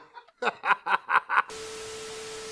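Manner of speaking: helpless breathy laugh; loud burst of laughter. Real, not acted. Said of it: "that's why I'm laughing".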